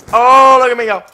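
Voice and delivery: loud taunting gloat; loud mock brag. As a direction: belt out the mock boast. Mock voice, repeated phrase.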